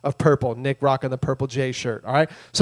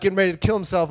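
ordinary delivery

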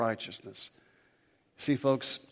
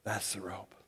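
breathy whispered emphasis; hushed reverent aside; 4 semitones lower, 10 dB quieter than usual. Acted. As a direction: drop to a whisper to land it. Whispered sermon point.

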